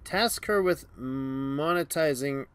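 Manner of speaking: normal talking voice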